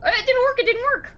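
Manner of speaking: worried shark. Retold or imitated. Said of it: "you're like".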